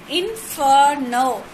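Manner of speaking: "Inferno" slowly split into in-fer-no; three separate beats on "Inferno"; syllable by syllable. Shown as a demonstration. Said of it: "three syllable word".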